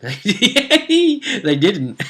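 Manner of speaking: high, loud laughter through the words; helpless amused laughter; laughing hard. Real, not acted. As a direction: crack up while saying it. Laughing at the players' choice.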